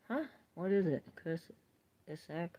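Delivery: very soft, trailing question; soft puzzled murmur. Real, not acted. Puzzling over a name in the chat, very soft. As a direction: barely voice it, puzzled.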